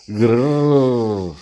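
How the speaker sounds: a small beast's grrr; baby growl. A narrator's reading. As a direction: growl it, rough and rumbling. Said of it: "εξακολουθούσε να γρυλίζει".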